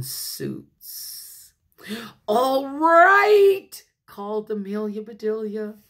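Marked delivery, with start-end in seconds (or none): 2.3-3.7 high, loud, drawn-out call. Acted. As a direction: call it out big to the whole party. Character calls out, ready.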